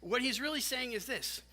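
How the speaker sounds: usual speaking voice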